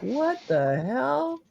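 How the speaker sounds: high, slow, drawn-out disbelief; retold bewilderment. Host recalling hearing a crash, mock bewildered. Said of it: "I was like".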